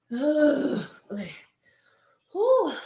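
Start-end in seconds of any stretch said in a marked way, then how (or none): none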